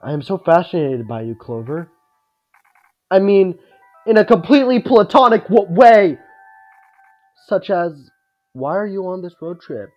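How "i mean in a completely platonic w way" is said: high, loud flustered backpedal; hurried embarrassed correction. Acted.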